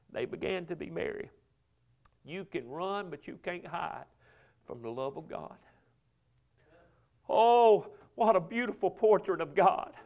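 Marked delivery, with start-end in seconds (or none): 0.1-5.6 hushed, much softer preaching; tender assurance. Real, not acted. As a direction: drop to a soft, reassuring close. Tender close of scripture reading.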